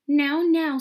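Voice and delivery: high, loud motherly interjection; Mother Duck soothing. A narrator's reading. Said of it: "said Mother Duck".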